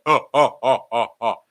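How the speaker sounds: short, repeated "oh" bursts; mock monster laugh. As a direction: imitate a monster's chuckle in even pulses. Imitates Yeti laughing on the weight.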